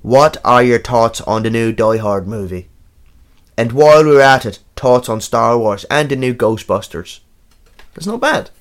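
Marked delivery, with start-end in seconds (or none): 0.0-2.7 slow, put-on Dublin accent; broad Dublin, with "thoughts" as "torts". Shown as a demonstration. Co-host reading a listener's question on request, Dublin accent. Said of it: "can you do, like, Dublin?". 3.6-7.2 broad Irish, drifting from Belfast to Dublin; Dublin accent. Shown as a demonstration. Co-host reading a listener's question, put-on Dublin accent. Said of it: "you travelled from Belfast to Dublin throughout".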